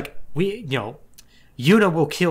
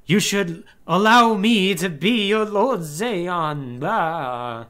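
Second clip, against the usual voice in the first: smug Seymour; 4 semitones higher, slower than usual. Retold or imitated. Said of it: "Seymour's like".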